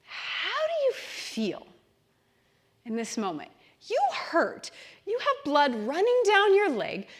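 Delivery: guided imagining. Acted. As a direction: lead them gently into the memory. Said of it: "as you imagine yourself as the kid".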